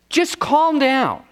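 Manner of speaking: high, drawn-out mock command; incredulous quote. Preacher questioning how anyone can say that, incredulous and high. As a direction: stretch it slowly as an exasperated order.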